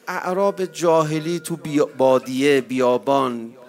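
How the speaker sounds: loud, rising sermon burst; a preacher thundering at mourners; fiery preaching. Real, not acted. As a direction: drive it home to the crowd. Preacher rising to full force.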